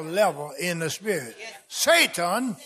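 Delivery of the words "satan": high, loud preacher's shout at Satan; slow, commanding shout; authoritative command. Shown as a demonstration. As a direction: order the devil away with full force, drawing the words out. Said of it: "that's your authoritative right".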